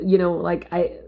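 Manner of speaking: ordinary talk, nothing marked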